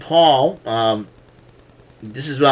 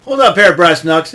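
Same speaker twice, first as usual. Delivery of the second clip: referee catching him; 6 semitones higher, 7 dB louder than usual. Retold or imitated. Referee catches Logan Paul.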